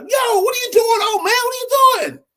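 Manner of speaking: high, loud startled cry; shocked high shout at the old man; scalded yell. Retold or imitated. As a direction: yelp as hot tea hits your lap. Student scalded by hot tea.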